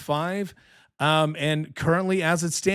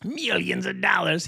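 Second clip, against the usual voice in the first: Doctor Evil; 2 semitones higher than usual. Retold or imitated. Host mocking candidate's lost campaign money, supervillain voice. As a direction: relish it like a cartoon supervillain. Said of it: "what is that, um, Doctor Evil?".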